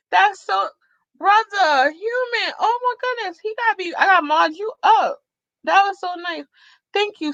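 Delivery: high, gushing thanks; touched gratitude. Real, not acted. Streamer thanking Brother Human for his gift, gushing. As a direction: gush with moved delight.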